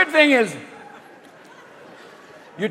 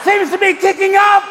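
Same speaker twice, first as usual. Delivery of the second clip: much higher, louder reporter yelling in wind; shouting over the storm; 13 semitones higher, 5 dB louder, slower than usual. Acted. Reporter on the coast in the storm answering Carl, yelling.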